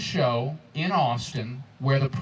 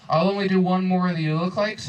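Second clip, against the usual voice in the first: ordinary delivery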